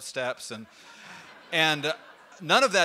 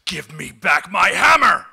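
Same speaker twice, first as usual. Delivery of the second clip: high, loud, slow demanding roar; furious god yelling at the giant's door; angry shout; 5 semitones higher, 13 dB louder, much slower than usual. Acted. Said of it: "Thor storms off".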